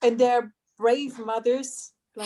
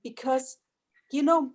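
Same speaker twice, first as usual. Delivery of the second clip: ordinary talk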